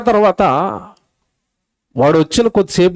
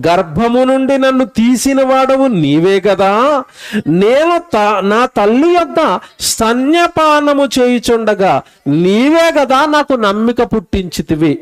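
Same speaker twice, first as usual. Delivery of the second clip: slow, raised reading of the psalm verse; scripture recitation; 4 semitones higher, slower than usual. Acted. Preacher reads the psalm to the congregation, slow and solemn. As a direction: intone the verse slowly and solemnly.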